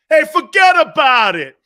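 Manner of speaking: loud, high East Coast shout; East Coast accent. Retold or imitated. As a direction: belt it like a brash New Yorker. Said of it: "I'm getting my accent back".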